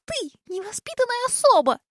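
very high, nasty little hiss; tiny dragon hissing spitefully. A narrator's reading. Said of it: "злобненько прошипел малюсенький золотой дракончик".

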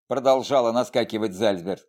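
plain reading voice

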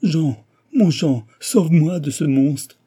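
high, loud, drawn-out cry for help; desperate appeal. A narrator's reading. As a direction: call desperately to your rescuer. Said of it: "un appel désespéré de la jeune fille".

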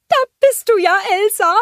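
very high, loud joyful snowman; Olaf. A narrator's reading. Snowman finding the queen, high joyful call. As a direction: call out squeakily with delight. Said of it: "rief Olaf freudig".

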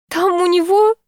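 high-pitched plaintive wail; whimpering. A narrator's reading. Distraught owner telling neighbour about the cat, whimpering. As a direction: whimper it high, as if near tears. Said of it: "всхлипнула я".

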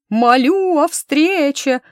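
high, loud, drawn-out wail; yelling in a silly voice. Acted. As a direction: bawl it in a ridiculous voice. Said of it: "орал Мордиш дурным голосом".

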